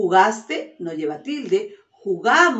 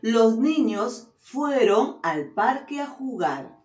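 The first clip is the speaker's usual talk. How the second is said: slow clear dictation; 2 semitones higher than usual. Shown as a demonstration. Teacher reading a sentence to pupils, slow and clear. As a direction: read slowly, every word laid out for the class.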